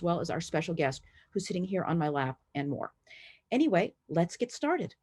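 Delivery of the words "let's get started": ordinary talk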